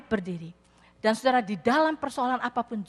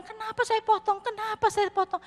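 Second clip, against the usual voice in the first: high-pitched mock wail; acted crying; 9 semitones higher than usual. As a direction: lament it high, as if crying, without real sobs. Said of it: "Dia nangis, dia bilang". (pura-pura menangis meratap)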